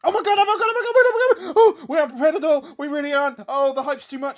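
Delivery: high shrieking hysterical laughter; shrieking jackpot winner. Acted. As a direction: squeal like you won the jackpot. Hysterical reaction to news.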